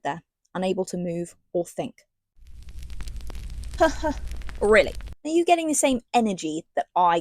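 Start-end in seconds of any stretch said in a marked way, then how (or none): 2.5-4.5 a high, breathy scoff of disbelief; airy, unbelieving laugh; incredulous laugh. Real, not acted. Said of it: "scoffing at the book".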